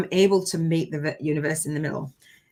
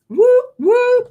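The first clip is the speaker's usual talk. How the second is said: high, loud double woo; excited whoops; 9 dB louder than usual. Real, not acted. Host cheering herself on during the live, high excited whoops. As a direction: celebrate out loud.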